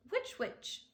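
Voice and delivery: high, savoured which witch; girl liking the sound of the words; playful which witch. A narrator's reading. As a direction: taste the rhyme playfully. Ramona savoring words.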